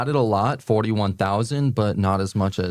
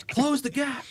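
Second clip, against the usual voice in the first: a hint of a South African accent; 4 dB quieter than usual. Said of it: "your best South African accent".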